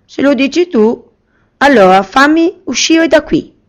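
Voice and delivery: put-on a boy daring the genie to prove it; eager young boy. Boy Aladdin commands the genie.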